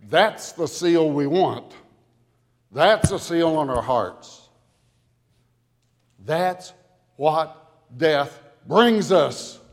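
rising fervour, peaking on Hallelujah; full-force preaching. Real, not acted. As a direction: preach it to the rafters. Building preaching climax.

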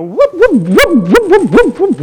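high, loud slapstick whooping; Three Stooges whoops. Acted. Preacher mimicking a Three Stooges movie, high loud whoops.

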